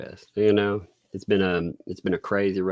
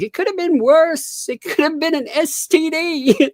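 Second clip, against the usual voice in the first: put-on much higher, slow Indian-accented doctor; much higher and slow, a hammed-up Indian accent; 5 dB louder than usual. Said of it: "he's a little Indian guy, so he has the accent".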